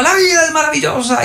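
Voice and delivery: imitación muy aguda de un maestro eufórico; grítalo agudo, como subido en un pupitre; grito imitado. Retold or imitated. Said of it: "se subía en el pupitre y gritaba". (grito agudo de alegría desbordada)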